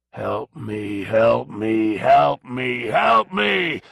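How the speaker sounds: eerie pleading rising to a shriek; rising to a scream. A narrator's reading. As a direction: let the plea climb into a scream. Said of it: "All the voices rose higher and louder".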